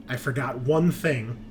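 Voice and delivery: ordinary talk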